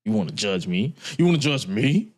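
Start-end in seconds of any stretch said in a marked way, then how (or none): 1.2-2.1 rising, affronted question; incredulous challenge. Acted. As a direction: pitch climbing sharply on ME. Repeats the challenge, lower then higher.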